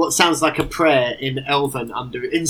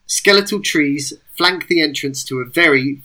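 usual speaking voice

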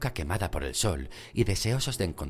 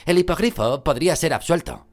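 firm, louder, determined; resolute; 8 semitones higher, 7 dB louder than usual. A narrator's reading. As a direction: push back with conviction. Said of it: "dijo Ron resueltamente".

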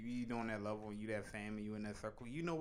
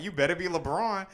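higher, louder sneering voice; louder mock scoff, higher pitch; mock sneering celebrity; 6 semitones higher, 9 dB louder than usual. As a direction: scoff like a snob who expects a star. Mocking celebrity circle voice.

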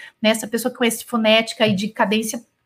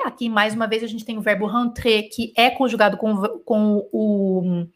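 ordinary delivery

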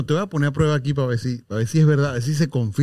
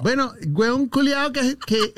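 high, drawn-out Chilean caricature; mock Chilean accent; 8 semitones higher, slower than usual. Host teasing with stock Chilean phrase, Chilean accent. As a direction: pitch it up into Chilean slang.

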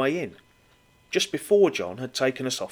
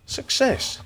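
mock triumph; 11 semitones higher than usual. Mock triumph after long search.

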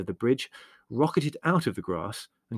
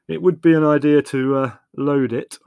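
pointed, triumphant suggestion, raised in volume; triumphant I-told-you-so, loud and higher; quiet triumph; 5 semitones higher, 12 dB louder than usual. A narrator's reading. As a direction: score a point with the obvious. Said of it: "with a certain quiet triumph".

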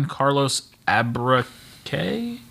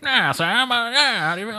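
put-on old-timey radio announcer, pitched way up; 1950s narrator; 13 semitones higher than usual. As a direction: ham it up like a vintage radio host. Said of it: "old-timey radio guy".

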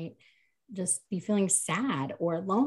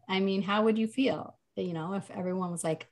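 ordinary talk

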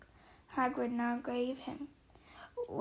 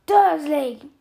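very loud booming call; 3 semitones higher, 15 dB louder than usual. A narrator's reading. Giant about to explode at the uncle, booming. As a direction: boom the name out in fury. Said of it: "he boomed".

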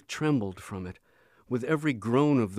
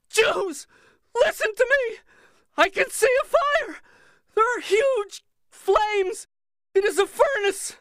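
high, loud, broken by sobs; breathless sobbing scream; 7 dB louder than usual. A narrator's reading. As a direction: wail it like a prophet possessed. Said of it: "She continued to scream, breathless, her voice broken by sobs".

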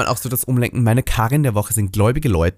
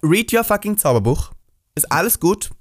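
high sassy diva voice; sassy diva telling-off; 3 semitones higher than usual. Pretend. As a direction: scold them like a fierce drag queen. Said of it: "Und dann bin ich so".

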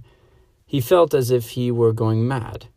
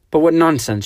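higher, louder protesting cry; cried out; 6 semitones higher, 7 dB louder than usual. A narrator's reading. Clarice to her husband, crying out in denial. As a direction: cry out against the thought, pleading. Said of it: "she cried".

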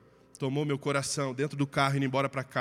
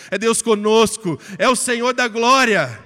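preaching at full force; 3 semitones higher, 8 dB louder than usual. Real, not acted. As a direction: proclaim it, rising to a shout. Climax of preaching, loud, slow. (pregação no auge, alto e cantado)

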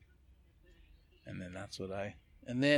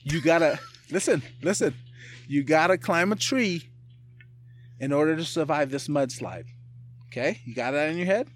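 animated and louder, pitch up, pushing through laughs; excited storytelling; 5 semitones higher, 5 dB louder than usual. Real, not acted. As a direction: sell the crazy scenario with energy. Excited mudslide scenario.

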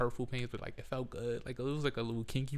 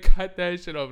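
mock boyfriend; 6 semitones higher, 16 dB louder than usual. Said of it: "He was like".

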